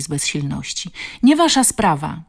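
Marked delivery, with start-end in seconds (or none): 1.2-2.2 tag. A narrator's reading. Bullied girl shouts back at boys.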